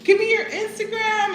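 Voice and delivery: sing-song gush. Retold or imitated. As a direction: sing it out like a fan. Lady asks for Instagram.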